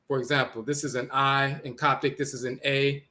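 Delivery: ordinary talk, nothing marked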